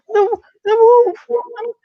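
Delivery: put-on high, wailing little-boy sob; mock sobbing child, voice high and breaking; imitating a crying child. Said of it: "bawling his eyes out".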